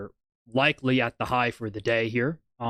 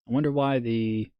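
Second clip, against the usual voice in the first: ordinary delivery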